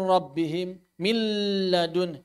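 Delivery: "milladun" with the sound held in the nose, very slow; wrong nasal hold. Shown as a demonstration. Teacher shows a wrong held nasal, slow and dragged. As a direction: hang on the nasal in "rabbihim, milladun". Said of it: "Menahan suara".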